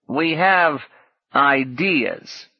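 a hint of a knowing tone; meaningfully. A narrator's reading. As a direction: let ideas hang with mild weight. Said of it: "Corduroy said meaningfully".

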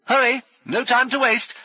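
high, loud hurry-up; shouted urging. A narrator's reading. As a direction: shout to rush them along. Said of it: "shouted Brummond".

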